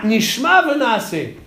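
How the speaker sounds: Nishma Venaase said high and slow, Nishma stressed; emphatic reversal. Shown as a demonstration. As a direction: stress the reversed order, Nishma first, slowly. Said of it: "il aurait fallu dire". (Nishma Venaase appuyé, aigu et lent)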